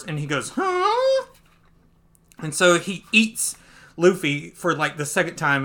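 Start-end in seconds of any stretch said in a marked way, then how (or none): 0.6-1.3 high-pitched questioning huh; baffled yelp. Acted. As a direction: squeak out a startled huh. Acting a character's baffled reaction.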